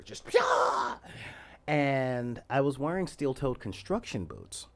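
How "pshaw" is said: high breathy kick shout out of a fighting game; Street Fighter kick yell. Retold or imitated. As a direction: blow it out like a video game kick. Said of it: "like Street Fighter".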